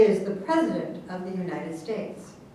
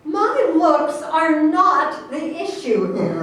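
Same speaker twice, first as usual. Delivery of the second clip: stern retort; 5 semitones higher, 4 dB louder, slower than usual. Acted. Candidate rebuts insult.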